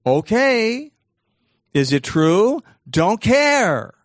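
high, loud, carefree brain voice; loud, high-pitched mock indifference; brain's voice. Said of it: "what will your brain say?".

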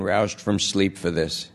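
plain reading voice

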